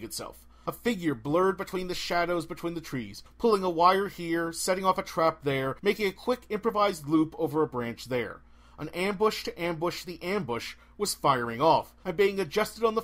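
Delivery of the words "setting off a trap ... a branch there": ordinary delivery, nothing marked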